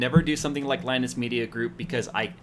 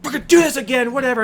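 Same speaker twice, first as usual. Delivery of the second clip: exasperated grumble; 11 semitones higher, 5 dB louder, faster than usual. Retold or imitated. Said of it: "it's like".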